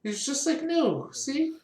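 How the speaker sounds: a hint of a cheerful mom; mother's voice. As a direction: reassure the child brightly. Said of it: "She's like".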